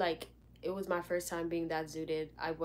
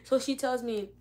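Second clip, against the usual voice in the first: ordinary delivery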